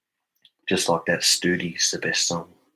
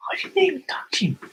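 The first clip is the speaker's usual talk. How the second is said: high thin whisper of innocence; high whispery mock-innocent voice; squeaky whispered denial; 4 dB louder than usual. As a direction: whisper it like a guilty kid.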